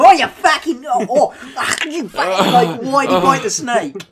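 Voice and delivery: loud, high, hammed-up Australian outrage; Australian accent. Imagining an onlooker at the snake bite, hammed-up Australian accent. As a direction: splutter it as an indignant Australian. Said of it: "I imagine to be Australian".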